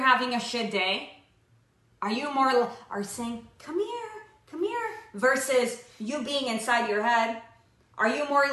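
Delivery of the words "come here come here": calling someone over. Pretend. Calling others to join in.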